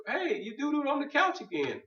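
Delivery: mock scolding dog owner.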